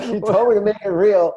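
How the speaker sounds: mock innocent excuse. Fighter excusing a real kick, mock innocent excuse. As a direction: shrug it off innocently. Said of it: "He's like".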